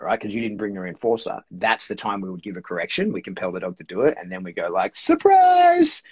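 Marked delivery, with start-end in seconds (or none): 5.0-6.0 very high, loud mock surprise; excited surprise reveal. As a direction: sing out a big playful reveal to the dog. Acts out revealing hidden reward to dog.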